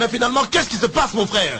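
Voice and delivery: high, fast, frazzled question; losing patience with the interpreter; exasperated. Acted. (exaspéré, voix haute et rapide)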